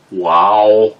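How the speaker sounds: put-on stunned exclamation; awestruck wow. Reacting to date handling the gun, awestruck. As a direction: let out an impressed wow. Acting out awe at date's skill.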